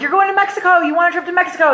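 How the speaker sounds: high, gushing mock congratulations; imitated excited mom. Mom calling about daughter's trip, excited. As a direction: mimic mom's high, thrilled voice.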